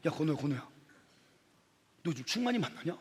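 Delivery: low, softly spoken approach to a friend; confiding gossip. Acted. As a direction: bring up gossip quietly. Another friend sidles up with gossip.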